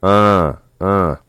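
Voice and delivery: a nasal ah. Shown as a demonstration. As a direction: let the ah ring in the nose. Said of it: "you're gonna make a nasal ah sound".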